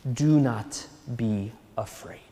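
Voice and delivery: whispered, gentle reassurance; soft comforting whisper. Acted. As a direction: breathe it softly like Jesus calming them. Jesus comforting disciples.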